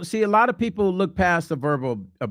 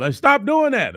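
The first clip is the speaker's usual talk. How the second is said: high, louder "Hey"; a man yelling at his partner in public, higher and louder; mock yell; 9 semitones higher, 4 dB louder than usual. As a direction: snap at her to stop. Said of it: "We might just yell".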